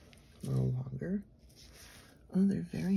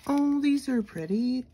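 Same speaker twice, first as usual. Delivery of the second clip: delighted; 9 semitones higher, 10 dB louder than usual. Real, not acted. Delighted at the new stickers.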